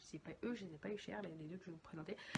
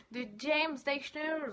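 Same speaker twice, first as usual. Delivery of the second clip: James Dashner said with an English lilt, slowed; name the author the English way; English accent; 5 semitones higher, 5 dB louder, slower than usual. Real, not acted. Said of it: "Faut que j'arrête avec mon accent". (prononcé à l'anglaise, James Dashner)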